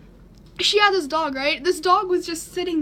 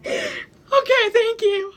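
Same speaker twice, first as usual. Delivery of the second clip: much higher, crying okay; tearful thanks; 8 semitones higher, 4 dB louder, much slower than usual. Retold or imitated. Teen hanging up with the operator, crying. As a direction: thank her while still crying.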